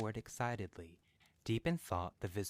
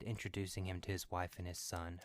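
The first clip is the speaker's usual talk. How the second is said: ordinary delivery, nothing marked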